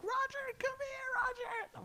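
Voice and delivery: very high, slow, wailing call of his name; imitated frightened family. Family calling him from the bedroom, high frightened wail. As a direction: call him like terrified kids. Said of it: "everybody in the house yelling".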